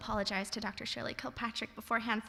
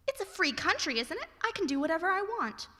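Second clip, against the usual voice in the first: put-on snotty kid; 8 semitones higher than usual. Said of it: "And you returned".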